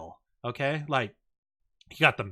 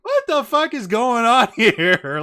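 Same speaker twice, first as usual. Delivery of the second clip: loud, high, drawn-out disbelief; acted incredulous wail with laughter; baffled outburst; 9 semitones higher, 10 dB louder, slower than usual. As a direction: cry it out, laughing, utterly bewildered. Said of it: "I'm just like".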